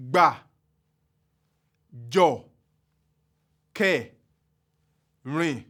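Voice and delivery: gba, jo, ke, rin each low and level, spaced apart; slow low tone drill. Shown as a demonstration. Teacher pronouncing Yoruba low tone words one by one. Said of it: "I'm going to go over that again".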